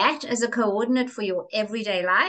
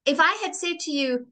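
ordinary talk, nothing marked

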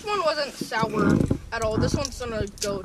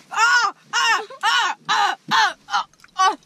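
repeated high loud yelps; sour shouts; 10 semitones higher, 10 dB louder, much slower than usual. Real, not acted. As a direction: yell oh over and over from sourness. Four warheads in mouth.

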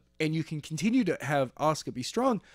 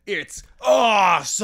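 loud, high, drawn-out mock bellow; hammed-up catchphrase shout; 6 semitones higher, 7 dB louder than usual. Actor Miz psyches himself up for the scene, hammed-up shout. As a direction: belt it out slowly like a show-off wrestler.

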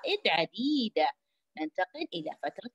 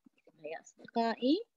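همس; 2 semitones higher, 5 dB quieter, faster than usual. Real, not acted. المعلمة تهمس للأطفال.

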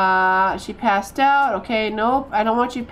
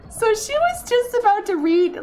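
laughing; 7 semitones higher than usual. Real, not acted. Retelling the funny moment.

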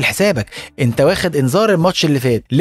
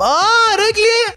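fake injured player; much slower than usual. Footballer faking an injury, high pained wail. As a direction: ham up a fake injury scream.

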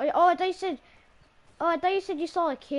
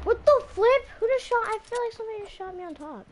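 indignant shock; 6 semitones higher than usual. Real, not acted. Player just shot by someone unseen, indignant shock. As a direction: burst out high, then trail into complaint.

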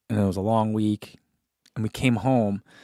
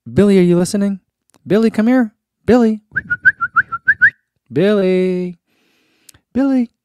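louder, higher sing-song call; calling the dog; 10 semitones higher, 8 dB louder than usual. Pretend. Host on livestream calling a viewer's dog Billy, loud and high. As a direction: call out to coax a dog over.

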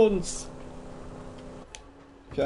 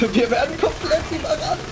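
panicking gamer; 8 semitones higher than usual. Real, not acted. Gamer overrun by enemies in game.

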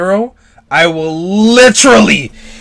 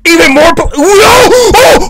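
very high cry of shock; horrified cry; 11 semitones higher, 3 dB louder than usual. Real, not acted. Viewer seeing more planes crash, horrified cry. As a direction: wail out in disbelief.

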